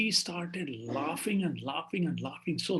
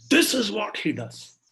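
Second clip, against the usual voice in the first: high start, falling firmly; triumphant declaration; 5 semitones higher, 4 dB louder than usual. Acted. Child on the throne answering the king, triumphant declaration. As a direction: proclaim it from on high.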